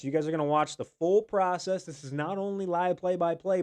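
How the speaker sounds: ordinary talk, nothing marked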